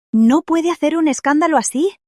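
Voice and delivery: indignant reproach. Acted. Staff confronts relative making a scene.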